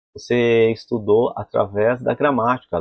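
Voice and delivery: ordinary talk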